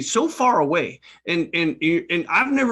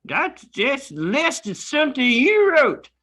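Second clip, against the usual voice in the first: put-on a cranky old prospector griping; cowboy prospector; 10 semitones higher than usual. S0 plays a Western prospector.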